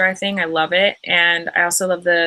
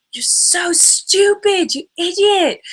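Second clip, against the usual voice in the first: high, slow, drawn-out mock scolding; hammed-up imitation of a man fed up with you; mock exasperation; 11 semitones higher, 4 dB louder, much slower than usual. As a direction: stretch the insult out high, in pretend outrage. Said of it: "he thought we were so dumb and annoying".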